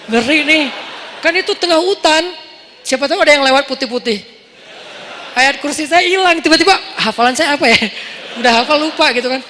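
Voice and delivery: mock fright. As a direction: play up the scared inner voice. Frightened inner voice at night. (ketakutan yang dilebih-lebihkan)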